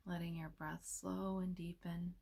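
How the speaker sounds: soft, unhurried meditation voice with long pauses; hushed, soothing delivery, leaving space between phrases; guided meditation. Real, not acted.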